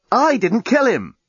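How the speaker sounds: high, slow, emphatic protest; exasperated denial. A narrator's reading. Journalist accused of murder, indignant denial. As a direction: deny it indignantly, drawing it out.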